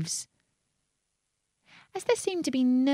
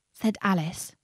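ordinary delivery, nothing marked

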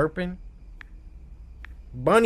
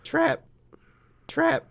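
high squeak; 11 semitones higher than usual. Pretend. Repeats word in high voice.

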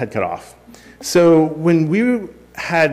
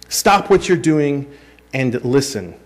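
normal talking voice

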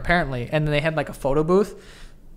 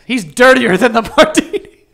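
laughing mock quote; 6 semitones higher, 9 dB louder than usual. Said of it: "It's like".

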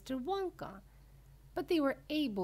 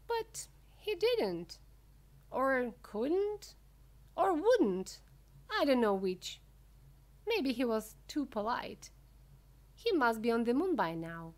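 lightly voiced showman, raised pitch; Mister Wonka, animated; 5 semitones higher, 6 dB louder than usual. Wonka wondering why he didn't burp, lightly voiced animation. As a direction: weigh each option with emphatic pauses. Narrator plays Wonka musing.

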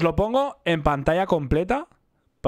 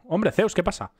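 usual speaking voice

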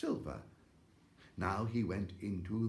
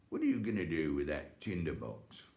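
soldier voice; 4 semitones lower than usual. Acted.

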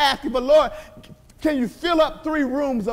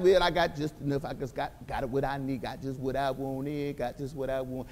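very low, soft, lazy drone; mock a smug believer, low and small; mocking the satisfied churchgoer in a low murmur; mock complacent believer; 13 semitones lower, 10 dB quieter than usual.

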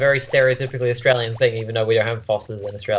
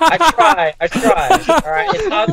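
laughing; 4 dB louder, slower than usual. Real, not acted. Laughing off failed accent attempt.

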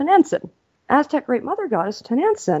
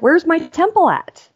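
higher, slow demanding question; mock demanding; 8 semitones higher than usual. Lady demands her temple from the peasant, slow and pushy. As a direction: ask like a boss who wants her temple now.

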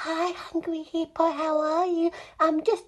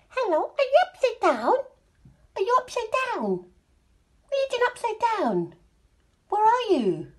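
high-pitched, sing-song questions; playful questioning; 7 semitones higher, faster than usual. Pretend. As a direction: coo puzzled questions at a toy. Playful questions to an upside-down toy.

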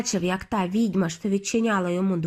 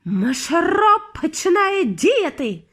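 gleeful witch; 7 semitones higher, 4 dB louder, slower than usual. A narrator's reading. Witch gloats over spell.

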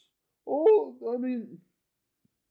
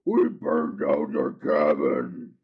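much lower, gruff goblin voice. Pretend. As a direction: growl it like a goblin. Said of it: "these goblins are saying".

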